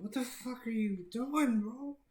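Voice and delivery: put-on shrill panicked girl telling him to stop; panicked girlfriend. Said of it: "She's like".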